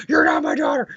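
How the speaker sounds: high-pitched random outburst; mock scream. Captain Stacy screaming at Gwen for no reason, high mock shout. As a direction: shout it high, as if suddenly crazed. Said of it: "just starts screaming for no reason".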